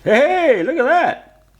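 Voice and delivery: high, loud cheer of delight; delighted exclamation. Real, not acted. Vlogger seeing the wire stripper work, delighted exclamation. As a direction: call it out, thrilled.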